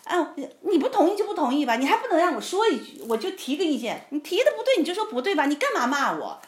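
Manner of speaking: high, loud, aggrieved argument; acted angry protest. Wife protesting husband's scolding, acted angry complaint. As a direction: relive the row, pushing each complaint at the other. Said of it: "我就很生气".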